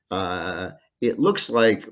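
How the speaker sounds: usual speaking voice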